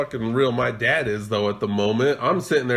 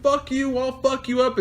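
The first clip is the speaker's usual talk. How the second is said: retold yelling; 10 semitones higher than usual. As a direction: yell back in a half-voiced retelling. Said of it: "he's yelling at me".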